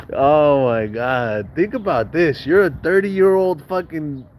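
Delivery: can't hold it in; high laughing fit. Real, not acted. Remembering Raul's excuse, laughing high and slow. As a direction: laugh high, then drawl oh my God slowly.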